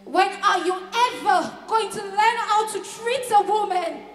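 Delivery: angry lover's outburst; exasperated scolding. Acted. Acted quarrel with partner Sam.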